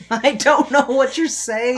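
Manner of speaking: high, slow, loud mock complaint; smart-ass heckle. Playing a smart-ass opera viewer who can't follow, heckling. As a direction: draw it out loudly, high-pitched. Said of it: "my smart-ass nature".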